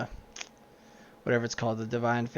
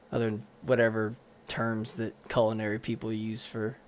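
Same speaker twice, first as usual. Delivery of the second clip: ordinary talk, nothing marked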